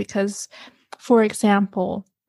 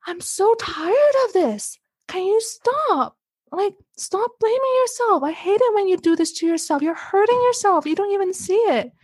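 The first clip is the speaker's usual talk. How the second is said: high-pitched, exasperated pleading; imitated frustration, high but not shouted; frustrated outburst; 9 semitones higher than usual. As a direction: act out a partner at the end of their rope. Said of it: "Yahim got so frustrated".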